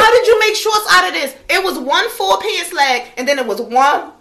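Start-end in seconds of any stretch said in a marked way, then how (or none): 0.0-1.3 incredulous. Retold or imitated. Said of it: "It looked like".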